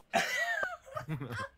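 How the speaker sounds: high squealing laugh. Real, not acted.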